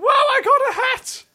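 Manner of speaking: very high falsetto celebrity impression; Emily Blunt. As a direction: squeak it in a silly high voice. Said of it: "Definitely Emily Blunt".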